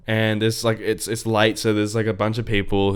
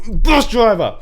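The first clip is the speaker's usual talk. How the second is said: angry rant; 10 semitones higher, 8 dB louder, slower than usual. Retold or imitated. Complaining to mum about driver.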